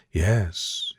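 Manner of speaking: ordinary delivery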